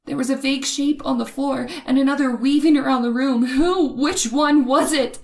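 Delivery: loud, high, frantic narration of a fight in the dark; breathless terror. Acted. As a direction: tell it as if it is still happening and you can't breathe.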